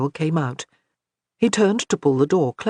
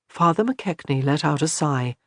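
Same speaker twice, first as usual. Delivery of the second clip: plain reading voice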